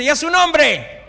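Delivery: slow, high, loud shout; shouted call. Shown as a demonstration. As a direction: call it out to the whole church. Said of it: "la que no dice". (grito lento y agudo a la congregación)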